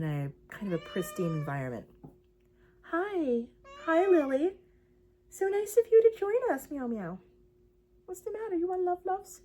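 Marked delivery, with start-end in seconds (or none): 2.8-9.4 talking to a beloved pet; cooing pet talk. Real, not acted. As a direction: coo sweetly in a high, lilting voice.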